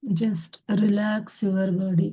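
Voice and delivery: very slow, soothing meditation guidance; guided meditation. Acted. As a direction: ease them into stillness, word by word. Guides meditation with long pauses.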